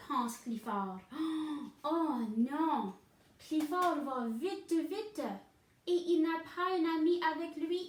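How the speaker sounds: ordinary delivery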